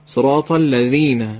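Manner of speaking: slow model of الذین with ذ on the tongue tip; dental dh in الذین. Shown as a demonstration.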